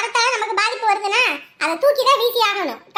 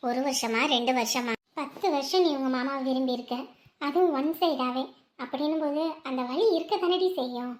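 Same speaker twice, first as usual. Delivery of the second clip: soft consoling; 6 semitones lower, 8 dB quieter than usual. Acted. Friend consoling a heartbroken girl.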